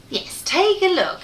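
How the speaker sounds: ordinary delivery, nothing marked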